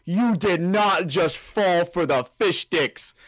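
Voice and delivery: loud, high, drawn-out incredulous call-out; mock disbelief. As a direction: imitate a friend stretching out every word in disbelief. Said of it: "Logan comes back and he goes".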